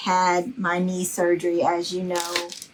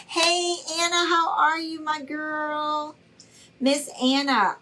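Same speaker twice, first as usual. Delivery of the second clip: high sing-song hello; sweet high call to Anna; excited greeting; 7 semitones higher than usual. Real, not acted. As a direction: lift the voice to greet a friend.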